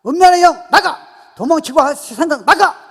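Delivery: loud, high bursts of command; rebuking evil spirits. Shown as a demonstration. Believer casting out lustful and fleeing thoughts, rebuking shout. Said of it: "나오는 거예요".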